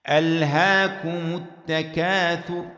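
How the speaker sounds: slow recited ألهاكم as alhakumu; damma on the mim. Shown as a demonstration. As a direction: draw out ألهاكم with a sounded u on the mim. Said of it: "فماذا نقول؟". (ألهاكمُ التكاثر بضم الميم ترتيلًا)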